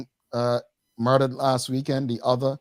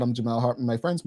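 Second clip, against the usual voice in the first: ordinary talk, nothing marked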